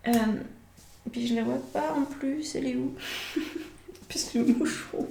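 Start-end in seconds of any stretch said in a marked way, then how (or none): 1.4-5.1 laughing through the words; amused, giggling as she speaks. Real, not acted. Bug flew at her, laughing.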